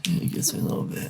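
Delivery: soft, near-whispered and shaky. Real, not acted. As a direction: voice wobbling with tears. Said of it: "no one will notice my shaky voice".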